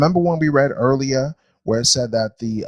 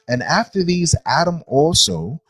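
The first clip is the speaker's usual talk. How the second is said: higher, slow, drawn-out verse reading; scripture reading; 4 semitones higher, slower than usual. Acted. Teacher reading about Adam and the chosen, emphatic scripture reading. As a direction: read it out with deliberate weight on each phrase.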